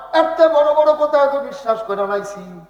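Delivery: high, loud proclamation that draws out; full-force preaching. Acted. Abu Bakr answers the challenge, high ringing shout. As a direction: proclaim it at a shout, easing late.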